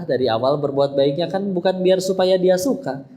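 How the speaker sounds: ordinary talk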